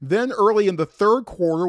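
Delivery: ordinary talk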